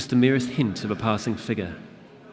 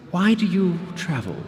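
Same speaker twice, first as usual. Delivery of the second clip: creature asking; 9 semitones higher, 4 dB louder than usual. Acted. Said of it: "the creature asked".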